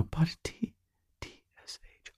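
whispered recall; whispering to oneself. Real, not acted. Trying to remember the old salutation, whispered to oneself. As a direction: mouth it under the breath, testing it.

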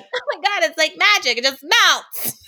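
high, loud gushing mom voice; mocking gushing moms. Mocking other moms who say the weight melts off. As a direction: gush like a smug new mom. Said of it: "Some people are like".

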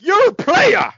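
very high, loud squawk; mock starstruck fan. Fan pointing at a ballplayer, high mock squeal.

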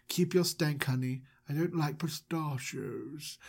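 lightly put-on host voice, soft; softly delivered, slightly strange character voice; Mister Manager. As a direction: play a hushed, odd host feigning a voice. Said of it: "his voice sounds a little different".

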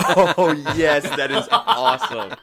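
loud, high, laughing through the words; can't stop laughing. Real, not acted. Bursts out laughing at story.

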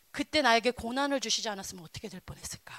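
unvoiced inner musing; hushed reflective whisper; whispered thought. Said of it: "이런 생각이 드는 거야".

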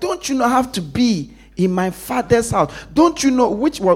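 insistent youthful protest; voicing Jesus. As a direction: make the parents feel they should know. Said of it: "It's like".

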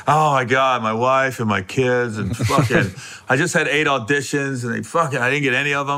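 low, weary mock lament; miserable complainer. Stranger answering a greeting, low mock-miserable griping. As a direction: moan it low, imitating someone unloading woes. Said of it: "like this".

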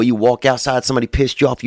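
ordinary talk

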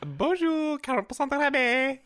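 loud, high-pitched French greeting; French sales spiel. Retold or imitated. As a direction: belt out the shop greeting in French.

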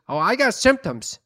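lightly voiced feisty grandma; grandma quote. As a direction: give grandma's cheeky answer. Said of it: "She goes".

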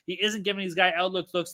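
normal talking voice

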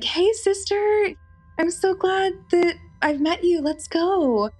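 high, put-on greeting from a creepy stranger. The stalker greets her in front of the guys, high fake-friendly voice. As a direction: play the fake-friendly stalker, pitched well up.

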